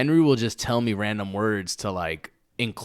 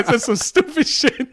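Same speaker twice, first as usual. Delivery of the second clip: laughing; 7 dB louder, slower than usual. Real, not acted.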